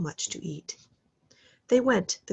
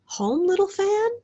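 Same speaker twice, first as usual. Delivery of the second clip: put-on higher, louder young boy; lightly voiced boy; 12 semitones higher, 5 dB louder than usual. A narrator's reading. The lonely boy answers his sister, higher boy voice. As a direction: ask with hopeful surprise.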